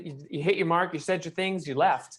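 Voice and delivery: ordinary talk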